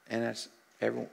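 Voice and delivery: ordinary talk, nothing marked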